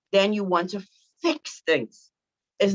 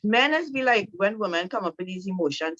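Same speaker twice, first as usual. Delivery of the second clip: ordinary talk, nothing marked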